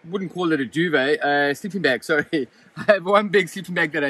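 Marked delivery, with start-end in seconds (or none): none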